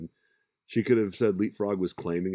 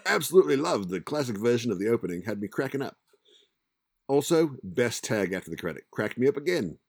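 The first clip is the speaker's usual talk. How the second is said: a hammed-up Australian accent, a bit higher and louder; 5 semitones higher, 5 dB louder than usual. Host reading a listener's comment in a corny Australian accent. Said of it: "I'm still gonna include my horrible accent".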